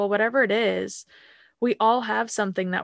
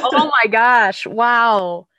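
loud, real astonishment; amazed; 4 semitones higher, 9 dB louder, much slower than usual. Real, not acted. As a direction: exclaim it, truly blown away. Amazed reaction to guest's story.

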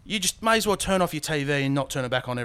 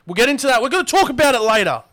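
raised, high voice cutting in; loud insistence; 7 semitones higher, 8 dB louder, faster than usual. Real, not acted. Host putting off a topic till later, loud insistence. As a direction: talk over him loudly, insisting. Loudly heading off the co-host's outburst.

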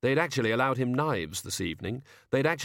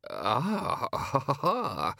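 dazed murmur; 4 semitones higher, much slower than usual. A narrator's reading. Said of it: "he murmured as his eyes started to glaze".

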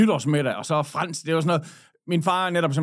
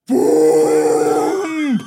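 loud imitated boom, blurted out; explosion sound; 3 semitones lower, 7 dB louder than usual. Retelling the viaduct blast to friends, loud boom. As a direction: mimic the blast with a sudden boom.

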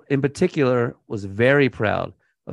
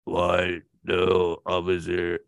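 voz baja y apagada, fingiendo inocencia; a mumbled, lower fake protest of innocence; low innocent mumble; 6 semitones lower, 4 dB quieter, slower than usual. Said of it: "Probably like".

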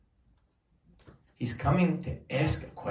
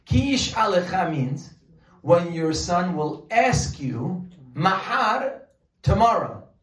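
"Ki yish'alecha" and "mahar" pushed high and loud, with slow, weighty pacing in between; stress on the key words; 4 semitones higher, 4 dB louder, slower than usual. Shown as a demonstration. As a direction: lean hard on "Ki yish'alecha" and "tomorrow" as the key words. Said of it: "Pay attention to these words".

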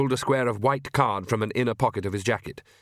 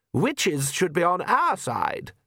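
higher, slow, cold countess; haughty countess; 6 semitones higher, much slower than usual. Acted. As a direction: purr it slowly like a vampire countess.

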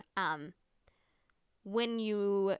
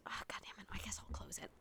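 unvoiced muttering to herself about the noisy window; whispered aside; 10 dB quieter, faster than usual. Real, not acted. Annoyed vlogger whispering about a garbage truck outside. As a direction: whisper it under your breath, annoyed.

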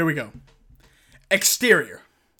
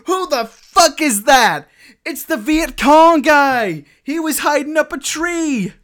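higher, louder and slow, an astonished cry; excited shout; 9 semitones higher, 5 dB louder, slower than usual. Acted. Narrating the shootout, an astonished higher shout. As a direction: bellow it out, thrilled and amazed. Acted shock at Viet Cong guy.

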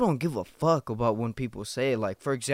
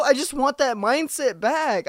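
much higher, pleading insistence; 10 semitones higher than usual. Real, not acted. Telling listeners he misses not caring, high pleading. Repeats longing line.

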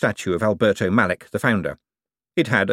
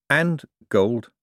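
"gold" set apart, as a reluctant afterthought; left hanging; 5 semitones higher than usual. A narrator's reading. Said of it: "gold was left hanging there".

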